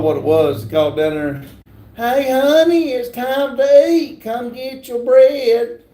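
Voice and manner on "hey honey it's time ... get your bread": high, drawn-out mom call to dinner. Acted. Streamer mimics a troll's mom calling him to dinner, high singsong voice. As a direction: holler sweetly like a mother, slowing down. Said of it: "His mom said it's dinner time".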